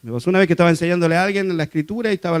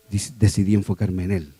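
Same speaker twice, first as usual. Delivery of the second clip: ordinary delivery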